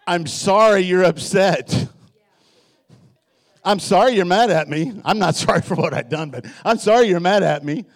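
insincere apology. Retold or imitated. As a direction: say sorry without meaning a word of it. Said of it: "the one I really get her with".

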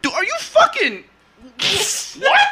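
very high-pitched mock incredulity; exasperated disbelief. Friends reacting to his lunchtime question, incredulous. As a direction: squeak out baffled outrage, hammed-up.

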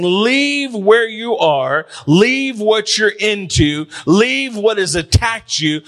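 slow, insistent preacher's plea; emphatic call. Acted. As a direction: stretch each repeated command, urging them in. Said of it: "I'm telling you emphatically".

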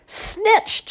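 put-on high, loud cry of an old woman; crying out, ancient woman. A narrator's reading. Old woman discovers the pumpkin gone, high outcry. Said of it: "cried in a seven hundred-year-old voice".

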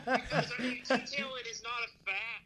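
preaching. Real, not acted. Egged on by cohosts to keep preaching, fired up and loud. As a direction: get fired up and drive the point home. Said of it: "You're preaching, man".

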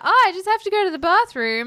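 put-on high, loud, fast girly voice; girl at the train party needing the bathroom, high and quick; ditzy girl. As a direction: chirp it like a tipsy party girl. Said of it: "one of the girls is like".

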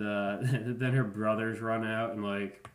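ordinary talk

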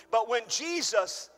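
ordinary delivery

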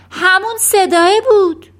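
loud, high excited yell; shouting. A narrator's reading. Brother recognising the same voice, shouting. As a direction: cry it out in alarm. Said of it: "برادر داد زد".

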